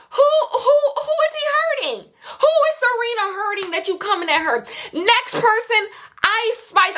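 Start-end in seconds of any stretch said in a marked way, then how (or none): 0.1-3.7 incredulous laugh. Real, not acted. Outraged on Serena's behalf.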